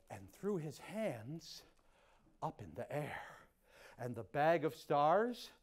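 plain reading voice

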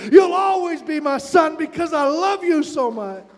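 forceful, rising pulpit fervour; impassioned preaching. Real, not acted. Preacher voicing welcoming father, then urging families, impassioned preaching. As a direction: proclaim it like a rousing sermon climax.

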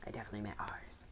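whispered aside, soft and breathless; villain whispering a slip-up correction; sneaky whisper. Acted. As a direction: whisper it like a guilty secret. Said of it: "I mean, our money".

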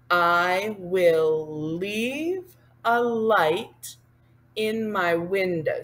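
very slow, word by word; slow spoken demo. Shown as a demonstration. Teacher pacing words to hand signs, slow speech.

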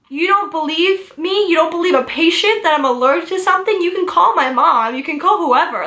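high, fast, outraged protest; indignant disbelief. Retold or imitated. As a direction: challenge the nurse who doubts you. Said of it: "I go".

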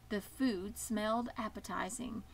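ordinary delivery

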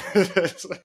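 sudden giggle breaking into the sentence; real laugh. Real, not acted. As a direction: burst out laughing mid-thought. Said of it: "Sorry, I'm laughing".

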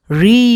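"রি" with a long rising vowel; model pronunciation. Shown as a demonstration. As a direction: sound out "রি" clearly, drawn out. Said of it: "সঠিক উচ্চারণ হলো". ("রি" টেনে স্পষ্ট উচ্চারণে)

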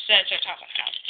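usual speaking voice

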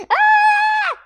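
high wild scream on the drop; excited scream. Real, not acted. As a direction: shriek with delight. Sudden drop on the slide.

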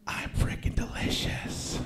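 slow breathy whisper; slow unvoiced whisper; creepy whisper. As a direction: whisper it like a hungry secret. Creepy whispered aside about being fat.

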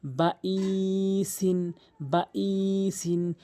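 slow recitation, short "বা" then a long held "ইসিন"; drawn-out i. Shown as a demonstration. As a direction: stretch the "ই" in "বা ইসিন" on a level tone. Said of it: "ই টান দিয়েছি কেন?". ("বা ইসিন"-এর ই টেনে পড়া)

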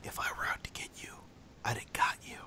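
menacing whisper. Retold or imitated. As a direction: hiss it quietly, threatening. Menacing retort in story.

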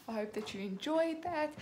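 plain reading voice

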